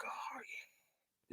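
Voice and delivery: breathy whispered craving; creepy whisper. Retold or imitated. The track marks opening up, creepy whisper. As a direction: whisper it like a hungry wound.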